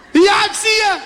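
high, loud shout into the phone; excited call-out. Acted. Girls phoning each other on the street, excited shout. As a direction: holler it out, very high. Acted call to someone in sight.

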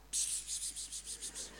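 soft unvoiced ps ps ps sounds; cat-calling psst. Shown as a demonstration. Demonstrating to the audience how you call a cat, soft ps ps ps. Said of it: "En wel deze klank".

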